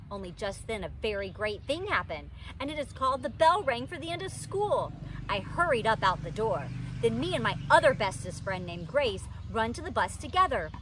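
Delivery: ordinary delivery, nothing marked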